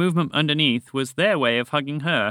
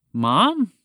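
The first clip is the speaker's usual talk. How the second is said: higher, louder startled cry of Mom?; shocked call; 6 semitones higher, 5 dB louder than usual. A narrator's reading. Tamar sees her mother, calling out shocked. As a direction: call out in sudden surprise. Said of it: "Tamar called in shock".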